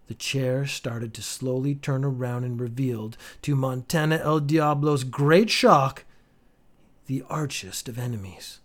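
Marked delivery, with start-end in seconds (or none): none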